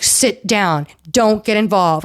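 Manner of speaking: ordinary talk, nothing marked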